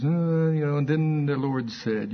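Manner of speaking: slow, offhand drawn-out delivery; idly preaching while inspecting his nails; casual preacher imitation. Pastor carrying on his message unbothered, casual imitation. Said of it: "You know how he does".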